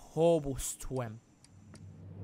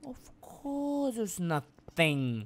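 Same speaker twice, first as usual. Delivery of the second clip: drawn-out sarcasm; 3 semitones higher, 4 dB quieter, much slower than usual. Real, not acted. Sarcastic complaint.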